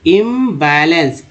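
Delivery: Imbalance slowly, im-ba-lance; syllable by syllable. Shown as a demonstration. Teacher adding IM to the word, imbalance slow and separated. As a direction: draw out the parts of imbalance. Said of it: "IM को इम बोलते हैं".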